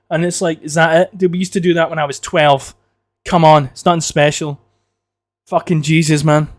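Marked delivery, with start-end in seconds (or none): none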